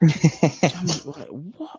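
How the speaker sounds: high, loud, incredulous "Jammy"; a touch of amused disbelief at the word. Real, not acted. Laughing at own insult.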